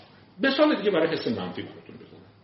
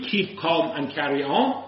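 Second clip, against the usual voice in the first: each word of Keep calm and carry on drawn out; slow slogan; much slower than usual. Shown as a demonstration. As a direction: read it like a poster slogan, very slow. Quoting the poster again. (شعار، خیلی کشیده و آهسته)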